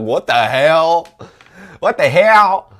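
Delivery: high, loud, drawn-out disbelief; escalating mock-shocked yell; shocked outrage. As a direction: imitate a scandalized sister exclaiming. Acts out sister's shocked reaction.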